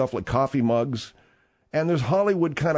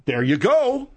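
excited; 9 semitones higher, 4 dB louder, slower than usual. Real, not acted. Triumphant agreement.

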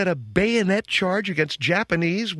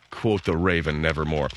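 lower, somber "Quote the Raven, nevermore"; sad reading; 10 semitones lower than usual. Shown as a demonstration. Host shows how the line should be read, low and sad. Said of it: "Just to read the thing like he's sad".

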